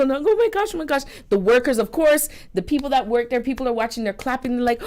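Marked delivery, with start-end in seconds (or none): 0.1-1.0 imitating a hysterical bride-to-be, high-pitched; high, put-on hysterics; mock hysterical. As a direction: play at being overcome, voice leaping up. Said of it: "she's getting all hysterical".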